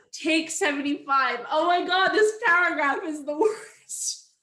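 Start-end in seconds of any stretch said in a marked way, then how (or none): 1.5-4.3 louder, higher exasperation; fed up with the script; exasperated outburst. Real, not acted. Exasperated at take seventy-five.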